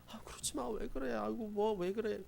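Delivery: hushed high mock soothing; soothing mom. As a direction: mimic a mom pleading with a tantrum. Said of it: "이렇게 달래주면요".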